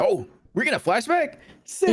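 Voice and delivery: thrilled to start the flashback; excited. Real, not acted.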